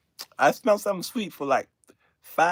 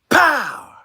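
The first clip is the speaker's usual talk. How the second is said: higher, louder blast; landing impact; 11 semitones higher, 9 dB louder than usual. Retold or imitated. The plane slamming the runway, loud blast. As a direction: slam out the impact noise.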